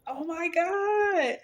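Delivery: high, slow, breathy "Oh my God"; excited gasp. Retold or imitated. Telling her husband about the dream, high slow amazed gasp. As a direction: relive the shock of the dream, high and drawn out. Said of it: "I was like".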